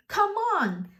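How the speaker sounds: "Come on" started high, then falling; stressed falling intonation. Retold or imitated. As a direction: punch COME ON high and let it drop. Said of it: "puts a stress on the word".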